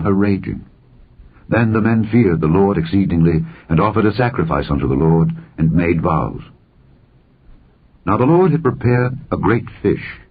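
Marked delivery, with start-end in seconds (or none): none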